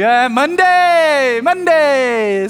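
high, loud, stretched-out groan of a word; drawn-out Monday wail. Acted. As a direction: wail it slowly, dragging out each vowel. Acts out his weary Monday mood.

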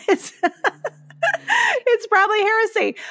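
laughing it off, voice jumping high; half-laughing admission. Real, not acted. Laughs at her own heresy.

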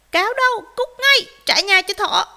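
high, very loud demand; barking shout. A narrator's reading. Dogs confront fox at the door, loud shouting. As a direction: order the fox out at full volume. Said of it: "bầy chó sủa vang".

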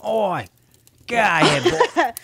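high, loud mock British "Oi"; mock British accent. Cohost jokingly voices the London neighbour in a mock British accent, high and loud. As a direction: bark it like a cartoon Londoner. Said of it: "I don't know what accent that was".

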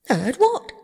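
high, startled query; alarmed question. A narrator's reading. Toad senses bad news from Rat, sudden alarm. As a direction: ask with sudden dread. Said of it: "turning rather pale".

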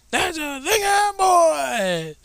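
very high, slow, wailing mock shout; hammed-up yelling cry. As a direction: wail it out like an overblown meme. Meme quote escalating.

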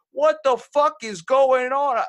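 high, loud, drawn-out disbelief; shocked outburst. Retold or imitated. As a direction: retell it as a stunned, slow yell. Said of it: "I was so shocked".

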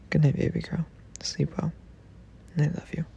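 soft unvoiced whisper; whispered goodnight. Real, not acted. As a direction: breathe goodnight like she's already asleep.

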